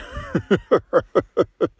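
bursting out laughing; a big belly laugh at his own joke; loud laugh. Acted. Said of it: "The bartender busts out laughing".

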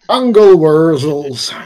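slow, drawn-out mock West Country accent; hammed-up West Country accent. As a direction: stretch it out like a West Country farmer.

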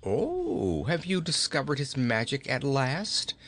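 theatrical gloating mockery; mocking laugh. A narrator's reading. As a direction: jeer at her with amusement. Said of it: "laughing in her face".

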